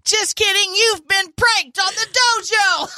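prank reveal laughing. Real, not acted. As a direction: shout the prank through laughter.

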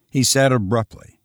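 ordinary delivery, nothing marked

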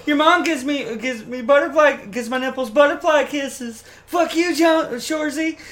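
high-pitched put-on taunting voice; show character chirp. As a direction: tease a rival in a squeaky character voice. Acting out the show's chirps again.

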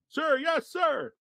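high yelled reply; recruit shout. Retold or imitated. Recruits answering sergeant.

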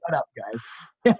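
slow, soft, fed-up imitation; a hint of an irritated host; annoyed retort. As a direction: drag it out like you're sick of them.

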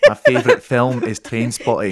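lilting Scottish character; Scottish accent. Pretend. [name] shares favorites in character, Scottish accent. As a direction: announce it as a put-on Scot. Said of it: "totally turned into someone".

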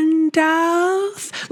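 slow Gandalf. Shown as a demonstration. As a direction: drag out Gandalf slowly, each syllable apart. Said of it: "he has to say it slowly".

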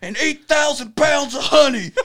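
high, slow laughing Southern announcement; Southern voice telling the crowd; loud announcement. Retold or imitated. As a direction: proclaim it to the whole room. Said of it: "loudly announcing to everyone".

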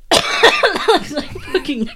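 slow words through laughter; laughing. Real, not acted. Speaking while laughing.

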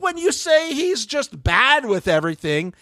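high, slow, emphatic telling-off; indignant scolding. Pretend. Podcaster putting it on record to Gone Girl, indignant scolding. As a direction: scold her in a high voice, stretching the words.

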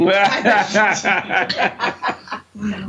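long, delighted belly laugh; big laugh. Real, not acted. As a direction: burst out laughing at the story. Bursts out laughing at story.

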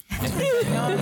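high, tight alarmed voice; lightly voiced dread at a stern father; startled squeak. Retells freezing before his father.

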